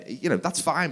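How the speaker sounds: ordinary delivery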